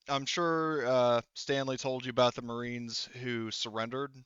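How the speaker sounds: ordinary talk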